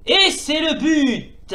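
slow drawn-out goal call; mild commentator goal cry. Acted.

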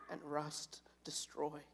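hushed whisper on "and rust destroy"; whispered emphasis. Shown as a demonstration. Pastor reading scripture to the congregation, whispering "and rust destroy" for emphasis. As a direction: drop to a whisper to make "and rust destroy" land. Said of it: "the words that I put special emphasis on".

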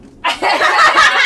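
shrieking laugh. Real, not acted. Proposal line read.

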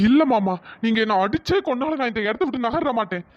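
ordinary talk

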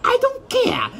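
very high, indignant refusal; angry squeal. Acted. Peppino refusing to help, angry squeal. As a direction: squeal it out in high-pitched annoyance.

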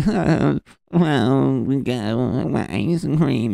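high, slow, sheepish excuse; meek dad. Pretend. The lost dad answers the escort, high and meekly drawn out. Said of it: "And he's like".

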